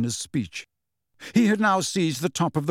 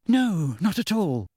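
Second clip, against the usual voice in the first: squeaking; 5 semitones higher than usual. A narrator's reading. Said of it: "squeaked Hermione".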